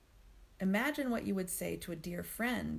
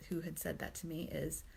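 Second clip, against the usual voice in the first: ordinary talk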